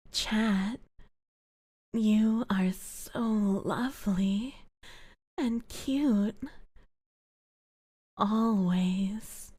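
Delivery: slow, unvoiced ASMR whisper; whispered ASMR compliment; soft whisper. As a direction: breathe it close to the mic.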